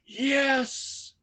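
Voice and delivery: high exultant victory yes; a fist-pump cry of triumph; triumphant Yes at the end of The Breakfast Club. Retold or imitated. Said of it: "he's like".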